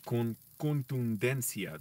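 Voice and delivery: contundencia said slowly, every T, D and N apart; slow careful. Shown as a demonstration. Learner drilling a hard Spanish word, saying contundencia slowly. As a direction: sound out con-tun-den-cia slowly. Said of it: "That's why I have to say it slowly".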